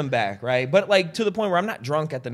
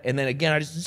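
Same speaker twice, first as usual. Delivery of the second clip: usual speaking voice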